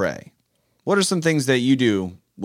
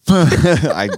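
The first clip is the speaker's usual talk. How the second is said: laughing; 4 semitones higher, 9 dB louder than usual. Real, not acted. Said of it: "I'm gonna try right now".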